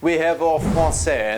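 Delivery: put-on slow, hammy French accent; horrible French accent. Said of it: "this is a horrible French accent".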